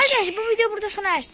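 hurried loud announcer sign-off; loud fast sign-off. Real, not acted. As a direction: rush the outro loudly. Announcing video's end. (hızlı ve yüksek sesle kapanış)

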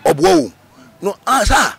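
high-pitched retold "he's lying!"; exclaimed retort. Speaker retells someone crying liar, high exclamation. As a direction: throw the voice up in protest. Same retort repeated as outcry.

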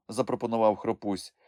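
ordinary delivery, nothing marked

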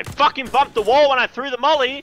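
frustrated yell. Real, not acted. Frustrated at own bad throw.

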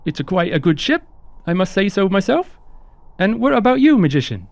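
high-pitched, apologetic crew member; chirpy high host voice; eager, polite ship hand Onion. Acted. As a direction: play a courteous, high-voiced deckhand, lilting upward.

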